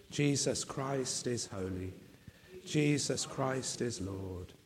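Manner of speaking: ordinary talk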